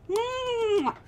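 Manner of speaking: loud kiss. Real, not acted.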